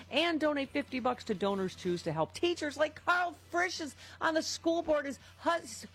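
high-pitched, worked-up plea; excited yelling. Real, not acted. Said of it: "Why am I yelling?".

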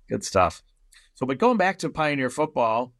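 normal talking voice

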